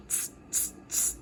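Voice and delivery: soft unvoiced tss tss of cheeky monkeys; monkey tsk-tsk. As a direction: click it back like teasing monkeys.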